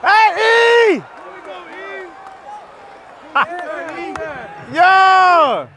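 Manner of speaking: ordinary talk, nothing marked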